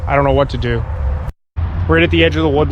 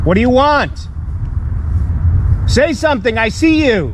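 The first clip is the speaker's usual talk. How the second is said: high, urgent call at intruders; scared yell; 8 semitones higher than usual. Real, not acted. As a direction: drive them away from the tent. Calling to unseen intruders.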